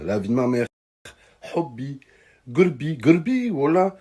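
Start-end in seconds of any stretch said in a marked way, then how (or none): 1.6-4.0 mock-cute pet names, pitched higher; cooing girlfriend impression. Imitating a girl sweet-talking him with higher, mock-cute pet names. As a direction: coo it like a lovestruck girl, tongue in cheek. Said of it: "elle me parle comme ça".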